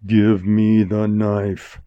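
slow, measured order; slow command. Acted. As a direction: space out each word, unyielding. Bandit repeats his command.

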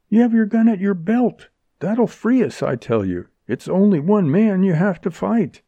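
high, urgent insistence; frantic eagerness. A narrator's reading. Woman urging Freddy to use his gun, frantically eager. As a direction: push him eagerly towards action. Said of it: "She pointed with frantic eagerness".

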